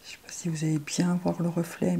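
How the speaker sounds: soft, hushed whisper to camera. Real, not acted. Whispering to viewers about the shimmer. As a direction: whisper as if sharing a secret. Worried viewers miss the shine.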